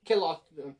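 Calostro in an Italian accent, G silent. Shown as a demonstration. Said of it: "trying to throw in the, the Italian accent".

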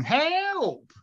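high, drawn-out cry for help; high-pitched cartoon distress yell; mock shout for help. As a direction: call out like a damsel in distress. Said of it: "but shouting".

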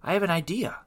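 excited, lifted voice. Acted. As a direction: pipe up excitedly with a new idea. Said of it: "excitedly said".

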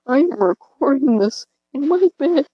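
real accidental voice crack. Real, not acted. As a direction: voice cracks upward mid-sentence. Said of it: "A voice crack".

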